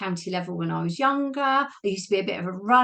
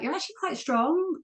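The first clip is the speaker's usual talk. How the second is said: high, lilting praise that sounds condescending; unintentionally patronizing; 10 semitones higher than usual. Retold or imitated. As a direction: mimic a coach's compliment so it comes out patronizing. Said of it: "didn't say it in a patronizing way".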